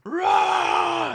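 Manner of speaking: a very high, very loud put-on roar; hammed-up war cry in his face; imitating a character's shout; mock battle roar. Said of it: "I'm surprised he didn't go".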